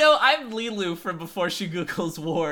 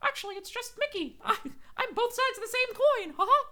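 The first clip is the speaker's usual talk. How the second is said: high squeaky Mickey voice; squeaky cartoon mouse, smug; evil Mickey voice; 3 dB quieter than usual.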